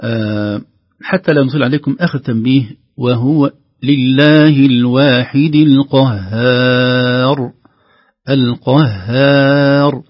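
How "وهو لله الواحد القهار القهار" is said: "القهار" with a thin ha between heavy letters, very slow and loud; light ha. Shown as a demonstration. Teacher reciting slowly to show the thin ha. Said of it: "نلاحظ أن الهاء".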